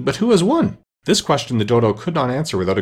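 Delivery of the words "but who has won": ordinary delivery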